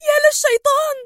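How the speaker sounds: high-pitched startled cry; alarmed exclamation. A narrator's reading. Villain reacts to the sight, alarmed exclamation.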